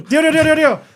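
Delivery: very high, loud, rapid-fire repetition; frantic high yapping. Pretend. Riffing on the accent-triggered dog, frantic high yapping. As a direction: yap it out in a hammed-up high voice.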